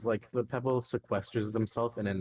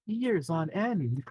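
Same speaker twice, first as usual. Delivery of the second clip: slow teasing; 9 semitones higher, 4 dB louder, much slower than usual. Real, not acted. Teasing drawn-out retort.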